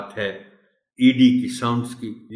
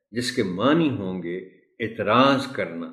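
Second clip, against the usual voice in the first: ordinary talk, nothing marked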